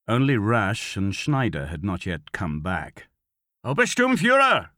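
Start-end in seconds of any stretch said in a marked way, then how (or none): 3.7-4.7 high, loud summons; calling out. A narrator's reading. Commander to lieutenant searching the house, calling out. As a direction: shout the rank to someone out of sight. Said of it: "Kroll called".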